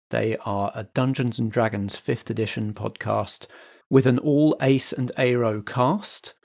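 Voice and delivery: ordinary talk, nothing marked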